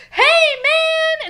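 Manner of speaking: high, loud drawn-out holler; Mariner's rowdy shout. Retold or imitated. Mariner hailing the Klingon before beating him, rowdy shout. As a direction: bellow a mock hail, stretching it out.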